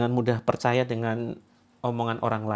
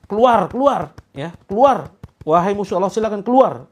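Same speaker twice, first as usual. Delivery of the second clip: loud, high barked command; stern shout; 7 semitones higher, 9 dB louder than usual. Shown as a demonstration. Showing the right way to rebuke a jinn, shouted. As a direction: order the jinn out with full force. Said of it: "Ini jangan pelan-pelan".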